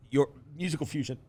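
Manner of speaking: choked up mid-question; choking on a drink. Real, not acted. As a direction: voice catching on a swallowed drink. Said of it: "I'm choking".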